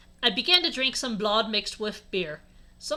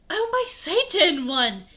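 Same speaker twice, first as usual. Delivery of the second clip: drawn-out, higher, louder gasp; gasped exclamation; 6 semitones higher, 5 dB louder than usual. A narrator's reading. Bloody Mary reacts to a friend's news, gasped. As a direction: exclaim it slowly like a mock gasp. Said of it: "gasped Bloody".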